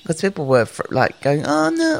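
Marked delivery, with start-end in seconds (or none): 1.5-2.0 high, squealing mock panic; hammed-up frightened passenger; mock panicked cry. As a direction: imitate a panicking passenger shrieking. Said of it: "people that start screaming and carrying on".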